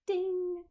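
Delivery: high bright ding; high ding of an idea; idea chime. Acted. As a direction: sing it like a bell going off. Idea sound effect.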